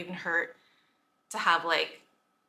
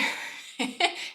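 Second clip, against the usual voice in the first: short airy laugh; 8 semitones higher than usual. Real, not acted. Laughing at own meanness.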